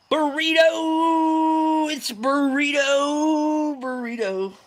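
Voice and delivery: long, high, loud hail; drawn-out holler. Real, not acted. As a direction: stretch the name out like an announcer, then ease off. Hollering a greeting to viewer Burrito.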